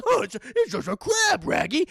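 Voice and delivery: high, garbled cartoon dog voice; excitable talking-dog impression; Scooby-Doo. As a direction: talk like a cartoon dog with R-heavy words. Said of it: "he goes, he goes".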